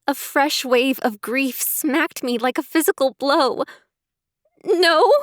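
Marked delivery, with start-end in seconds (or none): 4.6-5.1 high, loud sobbed no. Acted. Emily answering Sarah about Max, loud sob. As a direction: break into a sob on the word. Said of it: "I sobbed".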